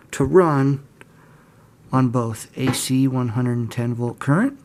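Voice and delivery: ordinary talk, nothing marked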